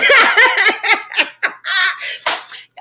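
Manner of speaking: real high-pitched laughter. Real, not acted. Speaker laughing at her own tap dancing plans, high laugh. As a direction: burst out in a long, high laugh.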